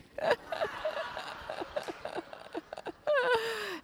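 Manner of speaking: high rueful laugh trailing off. Real, not acted. Pastor laughing at her Mother's Day story.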